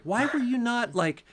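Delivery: high-pitched, indignant retold telling-off; angrier scolding. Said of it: "kinda angrier to say the stuff".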